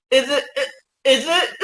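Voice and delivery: high, laughing stammer; bursting to say the secret; giggling guess. Real, not acted. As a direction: ask it through rising giggles. Giggling over the guessed identity.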